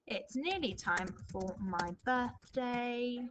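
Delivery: each word drawn out slowly; slow dictation. Shown as a demonstration. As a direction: dictate it slowly as you write. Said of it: "I'm gonna go for treat".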